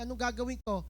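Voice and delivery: normal talking voice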